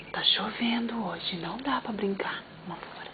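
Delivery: very soft whisper, fast at the end; soft whispered explanation to the pet. Real, not acted. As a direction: hush it like soothing a sleepy puppy. Repeats rain line whispered.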